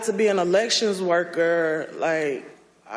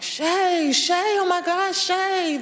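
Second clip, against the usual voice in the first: imitated panicked scream; 13 semitones higher, slower than usual. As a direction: call out in a high voice, imitating her screaming. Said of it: "screaming at the top of her lungs".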